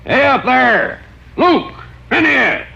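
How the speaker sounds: high, far-carrying hail up the hill; calling out. Acted. Clay hails the old-timers up on the hill, shouting. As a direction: shout up to the mine from below.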